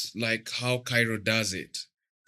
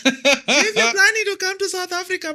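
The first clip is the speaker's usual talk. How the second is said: high-pitched South African girl impression; mock South African accent, high and bright; 5 dB louder than usual. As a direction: put on a broad South African accent, teasing. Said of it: "I'll try put the accent".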